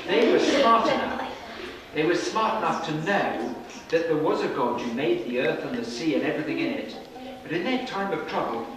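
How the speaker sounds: usual speaking voice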